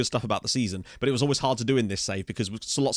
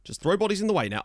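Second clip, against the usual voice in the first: ordinary delivery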